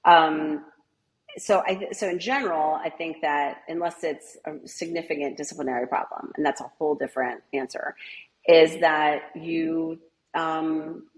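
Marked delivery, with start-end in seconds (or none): none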